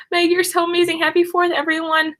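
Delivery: normal talking voice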